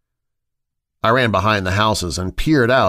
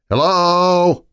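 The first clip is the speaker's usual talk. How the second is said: plain reading voice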